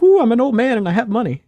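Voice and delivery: high, loud hammed-up voice of a fat jolly rich old man. Others' view of his late dad, hammed-up jolly old man. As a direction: lampoon a smug old money man.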